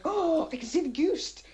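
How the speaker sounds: high-pitched excited cry; acted-out startled shout; excited exclamation. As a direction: blurt it out high and thrilled. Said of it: "he was like".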